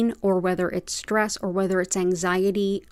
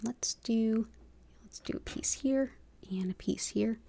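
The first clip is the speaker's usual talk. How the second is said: soft unvoiced whisper; 2 semitones higher, 9 dB quieter than usual. Real, not acted. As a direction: whisper to yourself while working. Quiet aside while placing stones.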